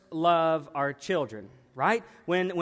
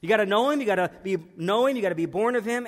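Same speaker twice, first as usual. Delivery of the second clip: usual speaking voice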